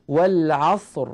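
والعصر said slowly with a thick, full final r; تفخيم الراء في والعصر; heavy r in wal-asr. Shown as a demonstration. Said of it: "مثال الراء الساكنة".

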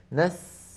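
"نس" with the s held on; soft flowing sin. Shown as a demonstration. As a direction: let the hiss at the end of "نس" keep flowing. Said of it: "من حروف الرخاوة".

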